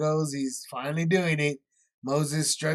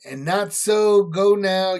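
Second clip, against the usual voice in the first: raised, forceful read of a royal order; commanding Pharaoh; 3 semitones higher, 6 dB louder, slower than usual. Acted. Pharaoh ordering Moses and Aaron out, loud and commanding. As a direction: boom it out like a king sending men away.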